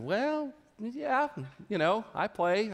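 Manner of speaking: stalling, hedging the golf question; hesitant. Retold or imitated. Pastor hedging when asked if he plays golf, unsure.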